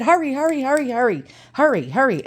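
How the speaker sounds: rapid-fire pestering that starts high and falls; high, insistent repetition, easing downward; urgent retold nagging. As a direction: re-enact badgering someone to move now. Rushing reluctant husband in retold quarrel.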